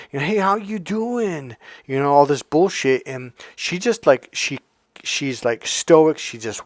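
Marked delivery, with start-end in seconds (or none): 0.2-1.5 high, slow drawn-out come-on; imitated pickup line.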